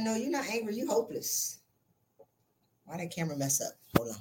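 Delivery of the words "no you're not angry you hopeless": softer, gentle repeat of the verdict; hushed, gentle delivery. Retold or imitated. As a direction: say it quietly, letting it land. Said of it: "I said".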